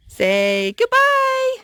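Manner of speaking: cheery prompt. Real, not acted. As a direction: sing it out so the little one joins in. Prompting child to say goodbye.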